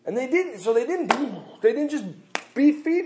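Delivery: high, loud fervent preaching; fired-up pulpit delivery; full-force preaching. Acted. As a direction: preach it to the rafters.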